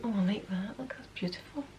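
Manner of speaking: soft breathy whisper to herself. Real, not acted. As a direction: hush it, just for you. Unvoiced whisper trying earrings.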